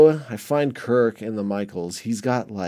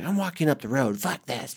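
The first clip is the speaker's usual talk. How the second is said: mock angry girlfriend; 4 semitones higher than usual. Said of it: "She's like".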